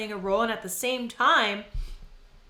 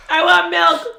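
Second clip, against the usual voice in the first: mouth on fire; 8 semitones higher, 13 dB louder than usual. Real, not acted. Mouth burning from hot sauce.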